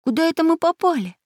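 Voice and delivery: high-pitched, wondering question; surprised. A narrator's reading. Child landing in a dark place, surprised. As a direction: ask it amazed in a high voice. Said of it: "удивлённо спросил Прутик".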